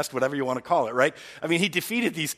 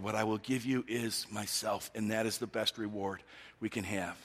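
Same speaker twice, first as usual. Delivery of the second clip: tender God; 4 semitones lower, 8 dB quieter than usual. Acted. Preacher voicing God's tender promise.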